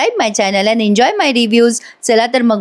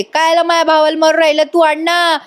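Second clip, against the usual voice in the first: retold yelling; 6 semitones higher than usual. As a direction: imitate an aunt crying out in protest. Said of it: "आत्या पण आहे तिथे आरडाओरडा करते". (उंच आवाजात आरडाओरड्याची नक्कल)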